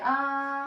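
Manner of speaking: long open ah, held as a model; sustained ah. Shown as a demonstration. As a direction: show a steady held ah. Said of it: "Open wide and say".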